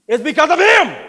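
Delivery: loud, high furious blame; angry outburst. Acted. Producer shouting at his agent about Victor. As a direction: yell it in his face.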